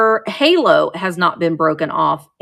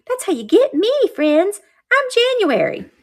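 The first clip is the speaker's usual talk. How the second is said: much higher, sing-song little angel voice; squeaky figurine voice; 11 semitones higher than usual. Pretend. Seller voices the January angel to her viewers, high and cute. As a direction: let the tiny angel introduce herself.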